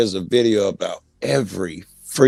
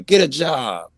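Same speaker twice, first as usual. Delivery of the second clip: money-worshipper's jibe; 6 semitones higher, slower than usual. Acted. Host mocks the guy whose god is money, lightly acted jeer. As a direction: lightly mimic a money-worshipper, pitched higher. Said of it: "he's the guy saying".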